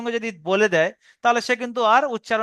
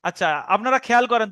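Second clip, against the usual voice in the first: ordinary delivery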